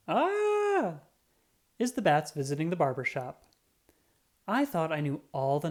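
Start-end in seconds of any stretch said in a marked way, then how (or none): 0.1-0.9 held high squealing cry; high bat cry. Acted. Narrator voicing the bats at the barber, a high cry.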